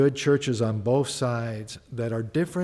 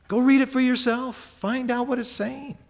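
ordinary talk, nothing marked

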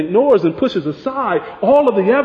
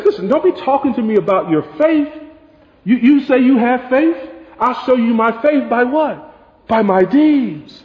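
challenging; 6 semitones higher than usual. Retold or imitated. James challenging empty faith talk.

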